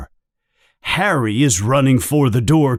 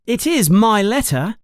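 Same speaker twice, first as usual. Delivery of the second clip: high, indignant insistence; furious; 11 semitones higher than usual. A narrator's reading. Harry furiously claiming his letter, high voice. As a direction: claim what is yours. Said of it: "Harry says furiously".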